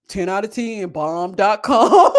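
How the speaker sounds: slow, stretched, laughing; laughing drawl. Retold or imitated. Savoring food verdict, laughing.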